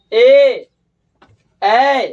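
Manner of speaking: ए and ऐ said loud and held; vowel demo. Shown as a demonstration. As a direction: sound out ए, ऐ for the class. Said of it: "दीर्घ ई". (स्वर का ज़ोरदार उच्चारण)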